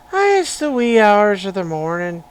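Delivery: loud, hammed-up Irish lilt; Irish accent. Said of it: "in an Irish accent".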